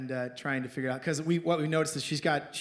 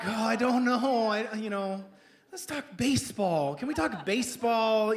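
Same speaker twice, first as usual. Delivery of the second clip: higher-pitched mock reluctance; uneasy dodge; 5 semitones higher than usual. Guest dodging the doctor's questions about politics, pleading. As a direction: plead to change the subject.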